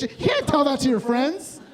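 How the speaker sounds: usual speaking voice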